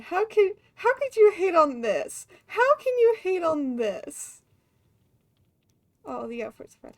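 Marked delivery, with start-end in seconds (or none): 0.0-4.4 louder, higher, mock-outraged pleading; indignant gush. Real, not acted. Streamer defending a cute outfit to chat, mock outraged. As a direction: demand how anyone could hate it.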